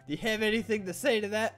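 ordinary delivery

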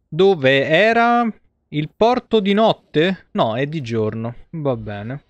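usual speaking voice

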